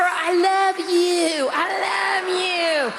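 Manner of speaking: slow, drawn-out mock whisper. To the intruder, a slow, overdone mock whisper. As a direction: breathe it slowly like a tender secret. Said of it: "I'm gonna whisper".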